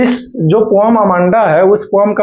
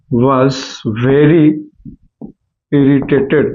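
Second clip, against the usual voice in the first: very slow and low, word by word; slow dictation; 4 semitones lower, much slower than usual. Shown as a demonstration. Teacher dictating the answer slowly to students. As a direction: dictate slowly so they can write. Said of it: "dictating answer slowly".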